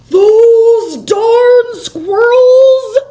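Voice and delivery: put-on high, slow, cranky old man shouting; old man yell. A narrator's reading. Old man yelling at the squirrels, slow high shout. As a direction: holler it like a fist-shaking grump. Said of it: "yelled Old Man Fuquire".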